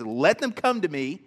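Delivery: "Let them come to me" loud and firm; "Let them come to me" with every word pressed; emphatic command. Acted. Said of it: "It's emphatic".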